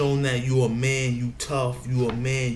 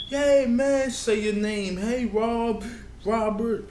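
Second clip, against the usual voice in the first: much higher, bright friendly hello; friendly greeter; 8 semitones higher than usual. Retold or imitated. A neighbour in Delaware greeting him happily, higher voiced. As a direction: greet him like an old buddy. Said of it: "He come to you and be like".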